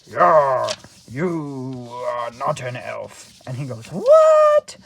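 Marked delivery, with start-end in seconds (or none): 0.1-3.4 slow, lightly voiced old elf; lightly acted elder, loud first word then slow; old man elf. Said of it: "the old man elf, tells him". 3.9-4.8 very high, loud comic yelp; hammed-up high-pitched outcry; mock shock. Buddy's shocked reply in retelling.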